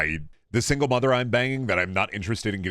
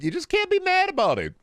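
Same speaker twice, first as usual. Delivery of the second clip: squeaky falsetto. Acted. Squeaky held-note bit.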